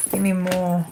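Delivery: normal talking voice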